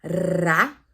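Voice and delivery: "Ra" with the tongue tapping up; tapped ra. Shown as a demonstration. Teacher contrasting mouth and throat sounds: "Ra" tapped. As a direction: flick the tongue for the R in "Ra". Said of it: "Mira la diferencia de mi boca para mi garganta".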